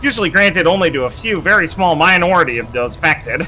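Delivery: arrogant, measured villain voice; cold scientist villain. Acted. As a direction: read it like a smug scientist. Reads villain dialogue aloud.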